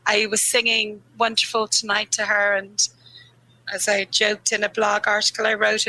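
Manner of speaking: ordinary talk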